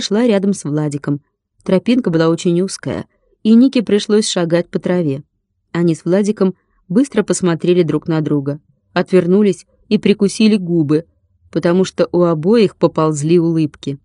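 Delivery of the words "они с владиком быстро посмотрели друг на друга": ordinary delivery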